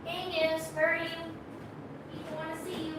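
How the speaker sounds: high, soft pet call from a distance; distant singsong calling; calling pets, far off. Real, not acted. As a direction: call the animals from far from the mic. Calling pets away from the mic.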